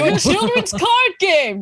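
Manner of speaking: high, loud parody proclamation; mock duel announcer. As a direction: proclaim it like an over-the-top cartoon duelist. Joking parody of the duel challenge.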